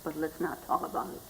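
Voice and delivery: quick, soft aside; hushed dismissal. Retold or imitated. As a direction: imitate the mother dropping the subject, fast and quietly. Mother backs away from the subject.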